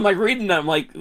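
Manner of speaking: usual speaking voice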